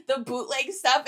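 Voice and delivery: ordinary talk, nothing marked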